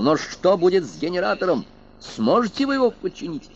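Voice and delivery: shouting. Acted. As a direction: shout back over him. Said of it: "Мэллоу теперь тоже кричал".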